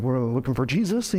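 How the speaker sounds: low, unsure mock request; lacking authority. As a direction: say it meekly, without any authority. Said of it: "can't imagine they said it with the same authority".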